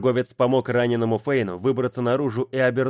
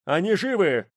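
high, excited cry from the crowd; joyful shout; 8 semitones higher than usual. A narrator's reading. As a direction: call out in sudden joy. Crowd cries out in joy.

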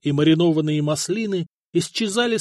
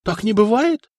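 high, loud, stressed NE; stunned, nearly shouting; incredulous outcry; shocked disbelief; 7 semitones higher, 6 dB louder than usual. A narrator's reading. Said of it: "Ставракий удивился так".

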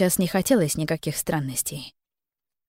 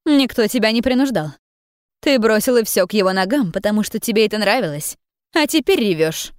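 angry scolding; 3 semitones higher than usual. Acted. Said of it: "Женщина сердито продолжила".

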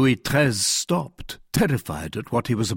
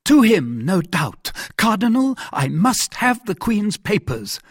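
high, louder, demanding monarch; jealous king's raised-pitch outburst; agitated king; 9 semitones higher, 4 dB louder than usual. Acted. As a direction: insist on it as a royal order.

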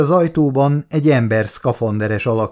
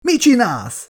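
panicked shout; 11 semitones higher than usual. Acted. Charlie panics as Ellie runs off.

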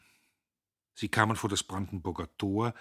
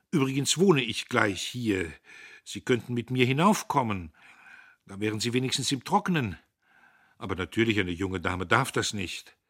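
put-on louder, higher young man's voice, amused; a cocky young man laughing off an old story; Dietrich amused; 5 semitones higher, 7 dB louder than usual. Dietrich tells Agnes, amused at himself, louder.

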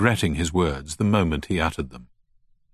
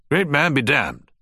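put-on raised, dismissive old gentleman; Turner, gruff scoff; 8 semitones higher than usual. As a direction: scoff it out high and sharp. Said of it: "said Turner".

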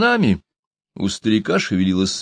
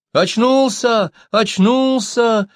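high, drawn-out shouts; children calling out, stretched and high; children's cry; 13 semitones higher, 4 dB louder, much slower than usual. A narrator's reading. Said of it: "закричали ребятишки".

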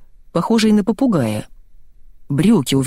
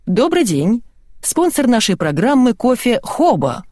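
higher, lively presenter patter; lightly voiced host announcing a sponsor; rattling TV host; 6 semitones higher than usual. A narrator's reading. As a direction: sell it like an emcee. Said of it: "снова зачастил".

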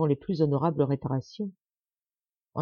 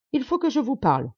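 brusque; 6 semitones higher than usual. A narrator's reading. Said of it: "dit-il brusquement".

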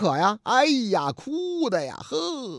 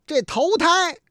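higher and louder, bursting out; mock complaint; 5 semitones higher, 6 dB louder than usual. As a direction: blurt out the grievance. Father finally reveals the reason. (父亲向警察诉苦，高声)

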